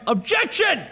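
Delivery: high-pitched, sharp outburst; yelled courtroom objection. Acted. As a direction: yell it out like a game lawyer. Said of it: "sitting in a break room and yelling".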